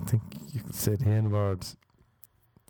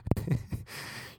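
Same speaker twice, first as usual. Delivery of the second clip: breathy laugh; 7 semitones higher, 5 dB quieter than usual. Real, not acted.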